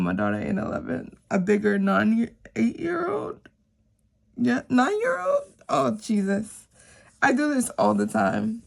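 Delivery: ordinary talk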